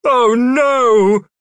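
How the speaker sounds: high, loud panicked cry; panicked shout. A narrator's reading. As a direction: yell it in panic. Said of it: "Heelkick shouted".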